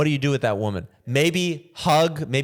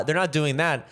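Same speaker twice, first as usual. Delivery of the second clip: ordinary talk, nothing marked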